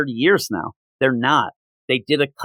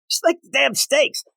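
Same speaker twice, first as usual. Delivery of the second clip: exasperated; 12 semitones higher than usual. Real, not acted. Ranting about the steaks.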